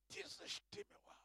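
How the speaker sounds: breathless whisper of wonder; awed whisper. Acted. Preacher marvels at the singer, awed whisper. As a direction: whisper it as if the voice moved you.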